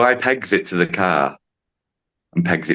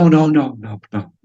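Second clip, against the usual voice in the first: acted refusal; 6 semitones higher than usual. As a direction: brush the idea off, imitating the client. Voices client refusing the suggestion.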